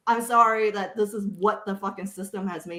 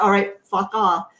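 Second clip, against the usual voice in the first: retorting; 6 semitones higher, 5 dB louder than usual. Retold or imitated. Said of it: "And I'm like".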